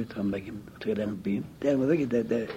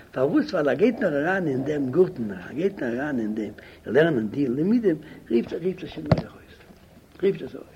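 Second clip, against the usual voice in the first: raised, higher voice, rapid and insistent; urgent rebbe's command; 4 semitones higher, 4 dB louder, much faster than usual. Retold or imitated. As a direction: order it loudly, as an impatient rebbe. Acted rebbe giving urgent orders. (א רבי באפעלט הויך און דרינגלעך)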